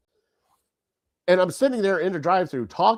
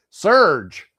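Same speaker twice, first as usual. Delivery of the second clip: sudden loud call from the back seat; kid blurting out; 4 semitones higher, 6 dB louder than usual. Retold or imitated. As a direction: shout the drink name out of nowhere. Kid blurts a random drink.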